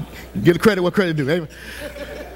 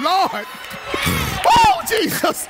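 pleading cry to heaven, pitched way up; mock desperate prayer; 5 semitones higher, slower than usual. Pastor mimicking the congregation praying for cancellation, pleading. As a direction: beg Jesus for a snow day. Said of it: "y'all was praying".

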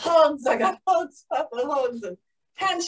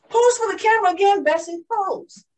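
high, loud, quick photographer's call; eager press call; 6 semitones higher, 4 dB louder than usual. Acted. Newspaper men to the returning pilot, loud eager demand. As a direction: call out urgently, stretching the last words.

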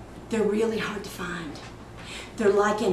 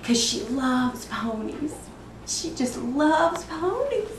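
slow, drawn-out doting gush, rising; 5 semitones higher, slower than usual. Acted. Mother telling of Brittany Lynn and ponies, doting gush. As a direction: linger on how much she adores it. Mother gushing over daughter in monologue.

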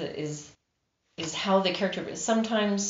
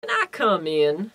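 mild narrated call, a bit louder; 5 dB louder than usual. A narrator's reading. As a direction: call out lightly to ask entry. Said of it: "Merle called out".

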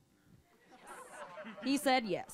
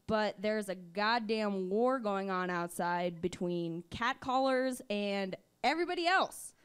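ordinary talk

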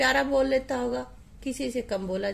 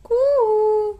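high loud coo like a cuckoo; cuckoo call; 10 semitones higher, 8 dB louder, much slower than usual. Shown as a demonstration. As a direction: imitate the bird's sweet call. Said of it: "जब बोलती है कू". (कोयल की ऊँची कूक)

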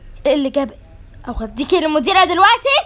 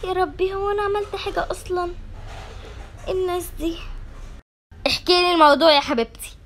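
usual speaking voice

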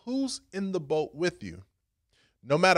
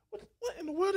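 startled confusion; 6 dB quieter, faster than usual. Retold or imitated. Jesus waking to panic.

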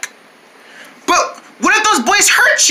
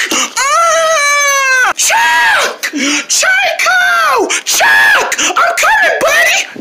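panicked scream; much slower than usual. Acted. Friend crying out as Chuck is grabbed, shrill scream. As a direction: scream his name like he's being dragged away.

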